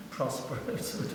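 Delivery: word squeezed out through laughter; breathy laughter round "prosperous"; laughing. Real, not acted. Laughs recalling serious father.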